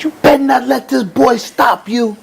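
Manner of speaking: high, pushed-up voice of his mother urging him on; imitating mom at ringside; mother's plea. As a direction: play the worried mom yelling from the corner. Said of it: "She said".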